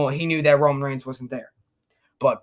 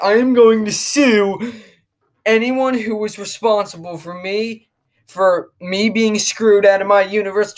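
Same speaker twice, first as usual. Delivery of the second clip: mildly indignant wrestler's declaration, raised pitch; mock indignation; 4 semitones higher than usual. Acting out wrestler threatening to sue.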